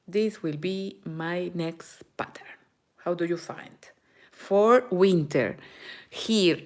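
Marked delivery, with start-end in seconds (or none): 3.0-3.8 quiet aside. Real, not acted. Asking viewers softly.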